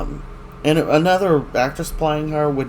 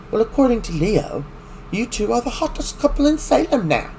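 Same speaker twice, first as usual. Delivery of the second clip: higher, sing-song catty gossip voice; mock catty Gwen; 9 semitones higher than usual. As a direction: tease them like a gossiping drama queen.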